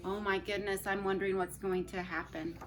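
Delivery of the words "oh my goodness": ordinary delivery, nothing marked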